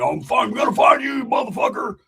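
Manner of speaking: angry threat. Acted. Roach threatening on the phone, shouting. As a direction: yell it into the phone.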